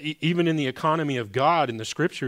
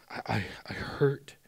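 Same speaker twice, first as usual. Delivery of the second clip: whispered, close to crying; breathless whisper on the edge of tears; near tears; 3 dB quieter than usual. Retold or imitated. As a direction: voice a hurting Jesus in a broken whisper. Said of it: "almost crying".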